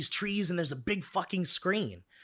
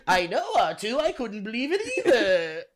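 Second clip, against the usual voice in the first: Star Wars character voice; 6 semitones higher, 4 dB louder than usual. Pretend. Character talks to robot.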